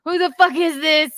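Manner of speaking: high, loud retold outburst; imitated screaming. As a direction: mimic a man yelling in disbelief. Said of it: "He's, like, screaming".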